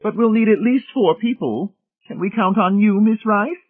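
loud, very high, emphatic matron; high-pitched, pushy insistence. A narrator's reading. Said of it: "saying emphatically in the other room".

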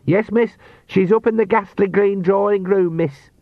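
put-on a flustered young servant announcing; servant boy voice. A narrator's reading. Sean voiced again.

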